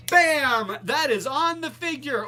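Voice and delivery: normal talking voice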